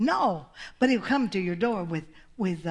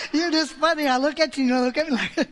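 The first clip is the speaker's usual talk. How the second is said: loud, higher, amused teasing; 5 semitones higher, 5 dB louder than usual. Real, not acted. Preacher teasing listeners who stare at her, amused. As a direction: talk through real amusement. Amused, between bursts of laughter.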